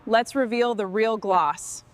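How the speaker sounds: ordinary talk, nothing marked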